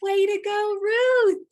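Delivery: high, loud cheering call; excited cheer. Real, not acted. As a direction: cheer her name out loud. Teacher cheering a child on.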